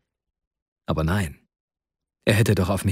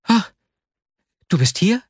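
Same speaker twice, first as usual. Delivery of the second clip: higher, louder startled cry; startled exclamation; 10 semitones higher, 4 dB louder than usual. Acted. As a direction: jump in fright and call out. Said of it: "rief er aus und fuhr vor Schreck zusammen". (erschrockener Ausruf)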